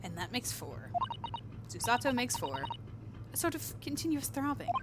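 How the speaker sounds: lightly voiced young woman. Voicing Susato, game dialogue.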